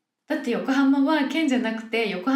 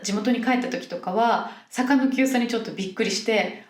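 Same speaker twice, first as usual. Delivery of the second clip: ordinary talk, nothing marked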